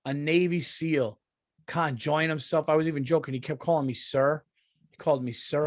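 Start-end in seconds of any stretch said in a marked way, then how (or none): none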